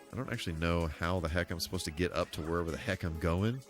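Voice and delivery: ordinary talk, nothing marked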